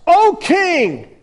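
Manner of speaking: high, loud hail to the king; royal address. Acted. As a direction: proclaim it grandly. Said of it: "I love his, his response".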